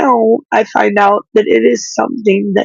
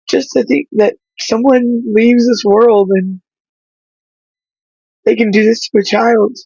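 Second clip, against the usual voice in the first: choked up; 4 semitones higher than usual. Real, not acted. Grieving over mother's death.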